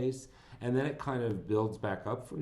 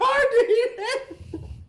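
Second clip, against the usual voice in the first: high, loud real laughter breaking out; sudden high laughing fit; burst of laughter; 10 dB louder than usual. Real, not acted. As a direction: crack up helplessly. Cracking up at the film.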